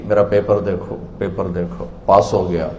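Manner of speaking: low, soft voicing; hushed acting. Passed soul showing others the paper, low and hushed. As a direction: say it low, nearly to yourself.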